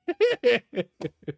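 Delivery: giggling at own joke; high laugh. Real, not acted.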